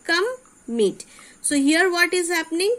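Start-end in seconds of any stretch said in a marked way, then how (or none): 0.1-1.1 "come, meet" said one syllable at a time; split into syllables. Shown as a demonstration. Teacher repeating the word for children, "come, meet" in separate syllables. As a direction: pause between "come" and "meet" to count two beats. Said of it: "Two syllable are there".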